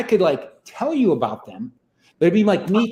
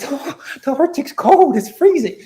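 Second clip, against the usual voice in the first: louder, wondering cry of cold; excited shiver; 10 semitones higher, 5 dB louder than usual. Retold or imitated. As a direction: marvel at the freezing cold. Clone feels cold at last.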